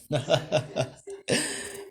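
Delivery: high, acted villainous chuckle; mocking laugh. A narrator's reading. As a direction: laugh at a beaten opponent. Deku laughs at Iron Jail's failure.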